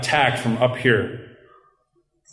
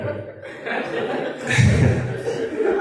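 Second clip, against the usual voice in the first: breathy escaped laugh; 4 dB quieter than usual. Real, not acted. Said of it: "Yeah, there it is".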